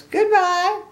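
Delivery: very high, loud chirp; Piggy's chirpy goodbye. A narrator's reading. Piggy to departing Gerald, high chirpy goodbye. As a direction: chirp it like a small pig. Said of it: "Piggy says".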